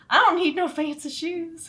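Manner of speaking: put-on high, laughing Southern kid voice; Southern accent. Refusing shoes while shopping, laughing Southern accent. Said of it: "Southern accent".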